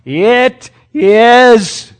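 loud, high cry from the cross. Retold or imitated. Jesus crying out from the cross, loud and high. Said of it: "he cries out in a loud voice".

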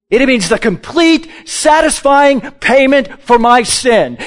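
preacher driving home the payment for sin to the church; fervent preaching. Acted. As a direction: build it into a full-throated proclamation. Preaching at full force.

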